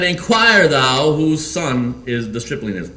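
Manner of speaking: slow, stately royal order; measured, commanding king; king's command. Acted.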